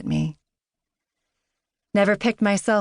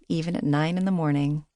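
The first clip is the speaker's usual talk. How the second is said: usual speaking voice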